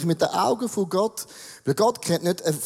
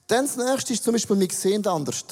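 normal talking voice

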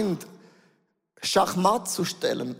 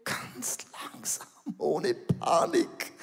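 slow hushed whisper; 3 semitones lower, 6 dB quieter, slower than usual. Acted. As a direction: breathe it softly, unhurried. Describing slow escape. (langsam geflüstert, ganz leise)

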